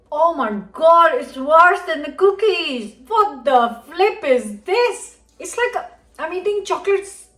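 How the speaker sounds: squealing revulsion at a chocolate sponge; disgusted shock. Real, not acted. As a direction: recoil in appalled disbelief. Tasting a spongy failed bake.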